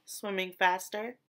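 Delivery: ordinary delivery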